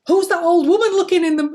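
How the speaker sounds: high, loud retold shriek at the mirror; mock scream. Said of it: "look into the mirror and scream out".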